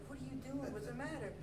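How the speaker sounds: high-pitched imitation of a worried mom; alarmed mother. Mom to her crying child at home, imitated alarm. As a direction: ask in a high, concerned voice.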